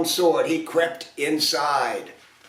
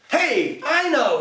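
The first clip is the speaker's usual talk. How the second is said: much higher, excited prince; sudden idea; 10 semitones higher, slower than usual. A narrator's reading. Prince to the fairies, high excited idea. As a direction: burst out with the idea.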